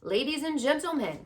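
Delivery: high, loud fair announcer call. Acted. Loudspeaker opens the prize speech, loud announcer call. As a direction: call the crowd to attention. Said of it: "continued the loudspeaker".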